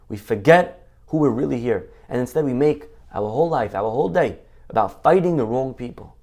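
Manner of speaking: normal talking voice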